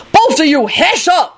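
high, fed-up scolding; cross grumble. A narrator's reading. Lucretia silencing the bickering pair, grumbling. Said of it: "Lucretia grumbled".

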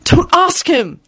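high frantic plea; panicked, pitch jumping up. A narrator's reading. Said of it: "he said frantically".

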